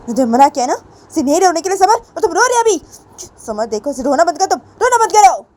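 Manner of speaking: loud, high, insistent telling-off; scolding. A narrator's reading. Teacher tells the student to stop crying, loudly scolding. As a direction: order firmly, rising to a sharp high peak.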